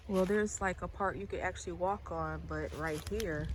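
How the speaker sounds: usual speaking voice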